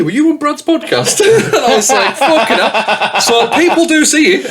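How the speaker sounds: high, laughing delivery, slowing as it goes; laughing through it. Real, not acted. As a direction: crack up while telling the punchline. Laughing at the retold story.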